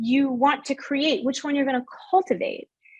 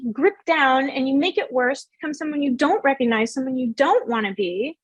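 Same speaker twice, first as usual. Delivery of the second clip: usual speaking voice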